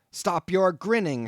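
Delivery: angry shout. A narrator's reading. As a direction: snap at the grinning landlord. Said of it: "shouted I".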